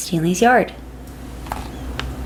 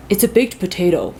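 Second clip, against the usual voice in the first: dad voice. A narrator's reading. Dad answers the Martian.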